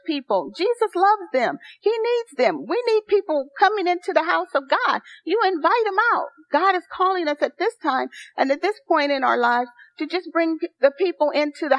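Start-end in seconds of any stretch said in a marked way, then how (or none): 0.6-8.1 higher, rising fervour in the sermon; real excitement, voice lifted higher; excited preaching. Real, not acted. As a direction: pitch up as the passion grows. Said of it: "and I'm getting excited".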